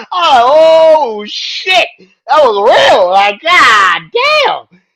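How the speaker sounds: high, loud, slow and laughing; can't believe it was real; stunned excitement. Real, not acted. Fan reliving the promo, laughing loud and amazed.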